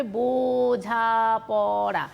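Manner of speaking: বোঝাপড়া with each vowel held long and level; flowing open syllables. Shown as a demonstration. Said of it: "এইরকম".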